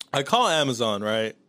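normal talking voice

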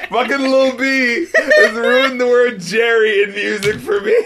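loud high laughing rant; laughing while yelling. Real, not acted. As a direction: shout it while cracking up. Laughing rant about Jerry.

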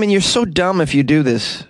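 ordinary talk

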